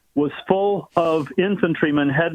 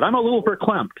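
lightly imitated teary comedy catchphrase, pitched up; a hint of playful tearing-up, higher voice; mock choked-up; 5 semitones higher, faster than usual. Moved cohost quotes a Saturday Night Live catchphrase, mock choked-up. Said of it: "guy would say it when he was tearing up".

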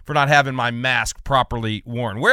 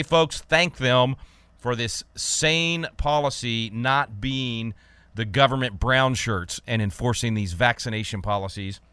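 ordinary talk, nothing marked

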